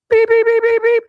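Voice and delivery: high, loud rapid beeping; imitated alarm beeps. Sump pump alarm going off in the basement, shrill imitated beeps. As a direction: mimic a piercing pump alarm on one pitch. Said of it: "and it's going".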